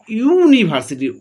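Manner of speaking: ইউনিভার্সিটি with the opening "yu" stretched out slowly; drawn-out first sound. Shown as a demonstration. Teacher sounding out a word for students, ইউনিভার্সিটি with drawn-out "yu". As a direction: linger on the "yu" of ইউনিভার্সিটি. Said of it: "উচ্চারণের ভিত্তিতে যদি দেখি".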